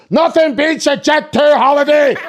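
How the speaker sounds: high, slow hammed-up Northern Irish ad slogan; Northern Irish accent. Girlfriend reciting UK advert, shrieked Northern Irish accent. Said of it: "so her accent is fucked".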